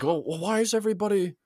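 a lightly voiced old wizard, fast then trailing off; airy, knowing grumble of a subway sage; a hint of a weary old mage; wise wizard voice.

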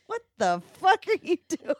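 high, loud exasperated cry; imagined outburst. Pretend. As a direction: shriek it in disbelief. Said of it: "have her go".

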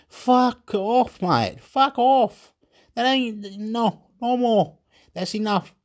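high, exasperated telling-off; fed-up refusal. Retold or imitated. Fed-up people telling authorities off, angry refusal. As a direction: push them away with a high-pitched, drawn-out refusal.

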